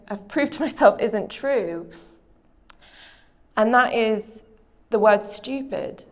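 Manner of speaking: ordinary talk, nothing marked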